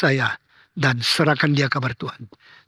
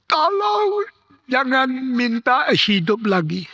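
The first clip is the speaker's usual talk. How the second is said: weak cry; 8 semitones higher, slower than usual. Retold or imitated. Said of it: "dengan suara yang sangat kecil berteriak".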